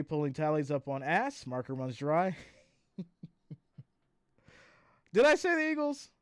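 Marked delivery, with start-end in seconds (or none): 5.1-6.1 surprised. Real, not acted. Realizes own mistake.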